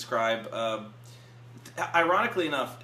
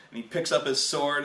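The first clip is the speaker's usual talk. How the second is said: ordinary talk, nothing marked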